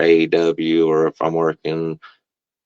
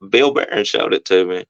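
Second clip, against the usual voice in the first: normal talking voice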